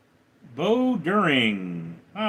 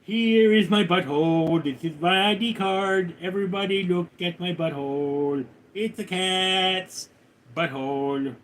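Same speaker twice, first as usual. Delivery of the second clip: cat's higher, droning announcement voice; silly put-on cat voice, showing itself off; talking cat; 3 semitones higher than usual. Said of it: "Well, you're a cat, so I mean, it's like".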